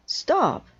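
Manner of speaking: high, loud shout of stop; sharp shout. Acted. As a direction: cut them off with a yell. Conductor halts the counting.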